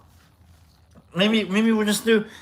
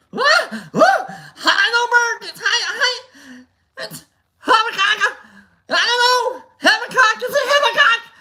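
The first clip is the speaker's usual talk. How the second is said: much higher, louder, laughing shriek; laughing scream; 6 dB louder than usual. Retold or imitated. Vlogger retells screaming at the movie, laughing and high. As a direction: shriek the name through laughter. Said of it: "I screamed out Habakkuk".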